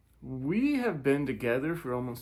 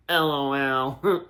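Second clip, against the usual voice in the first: usual speaking voice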